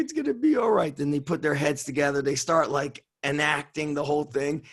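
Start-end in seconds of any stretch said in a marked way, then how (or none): 0.0-1.0 mock reassuring. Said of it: "He's like".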